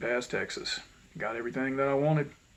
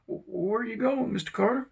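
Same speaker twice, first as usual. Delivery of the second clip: surprised lady; 10 semitones higher than usual. Retold or imitated. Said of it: "And one lady said".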